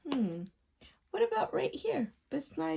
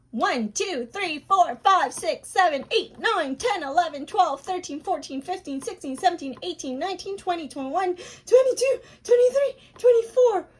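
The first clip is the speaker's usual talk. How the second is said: counting at top speed, getting louder toward the end; as fast as you can; 6 semitones higher, 9 dB louder than usual. Shown as a demonstration. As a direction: race through the numbers, building to the finish. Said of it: "super de duper as fast as you can".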